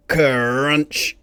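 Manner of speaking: ordinary delivery, nothing marked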